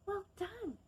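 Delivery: usual speaking voice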